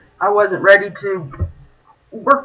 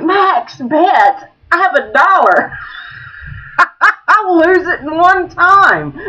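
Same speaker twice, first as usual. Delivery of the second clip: high-pitched disbelief with laughter; incredulous protest; 9 semitones higher, slower than usual. Retold or imitated. Player protests the big-bet advice, comic alarm. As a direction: balk at the idea, pitch climbing.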